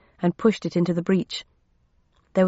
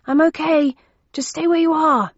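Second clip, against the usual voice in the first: yelling upstairs; 9 semitones higher, 4 dB louder than usual. Acted. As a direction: shout it up to the next floor. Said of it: "I yelled upstairs".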